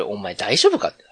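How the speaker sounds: ordinary delivery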